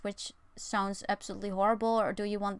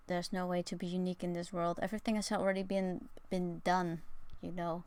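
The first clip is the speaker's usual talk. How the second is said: normal talking voice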